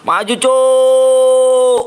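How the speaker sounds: stretched rallying war cry; battle cry. Real, not acted. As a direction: hold the shout like charging into battle. Gamer yelling charge in firefight.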